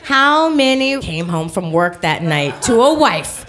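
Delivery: usual speaking voice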